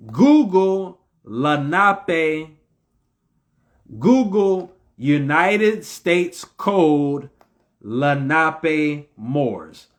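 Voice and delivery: very slow, spaced-out words; slow dictation. Real, not acted. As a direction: dictate it word by word, drawn out. Dictating a search phrase to listeners.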